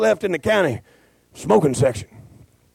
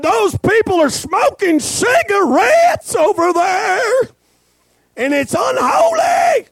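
much higher, loud, slow mock shriek of an offended churchwoman; outraged holy lady; 4 dB louder, slower than usual. Pastor mimicking the holy lady complaining about smokers, high mock shriek. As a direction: ham up the scandalized church lady yelling. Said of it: "She said".

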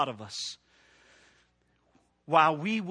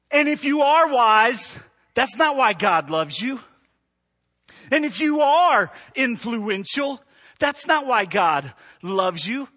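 preaching at full force; 3 semitones higher, 5 dB louder than usual. Acted. Preaching refrain.

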